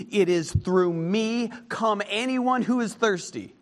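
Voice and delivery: slow, high, loud pleading call; preaching at full force. Acted. Preacher urging listeners to come to Jesus, full-force preaching. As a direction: call them in, slow and big.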